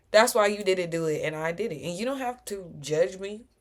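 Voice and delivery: ordinary talk, nothing marked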